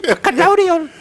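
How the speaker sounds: high falsetto fan voice; besotted admirer. Acted. Imagined fan praising the accent, mock gushing. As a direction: gush it in a put-on high voice.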